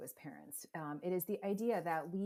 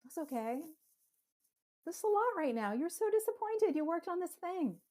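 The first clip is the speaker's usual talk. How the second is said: higher, sing-song comfort; soothing parent; 12 semitones higher, 4 dB louder than usual. Shown as a demonstration. Parent consoling a disappointed child, high and tender. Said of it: "be able to say".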